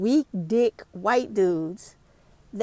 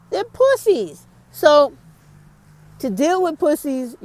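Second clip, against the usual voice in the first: much higher and louder, spitting the insult; scornful outburst; 5 semitones higher, 5 dB louder than usual. Real, not acted. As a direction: fling the word out with contempt. Contemptuous rant peak.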